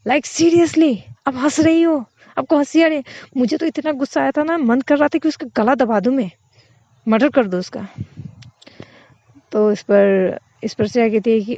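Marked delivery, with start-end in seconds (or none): 0.1-7.9 indignant outburst. Acted. Friend telling her partner, outraged and much higher. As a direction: vent it like you could strangle him. Furious friend vents about colleague.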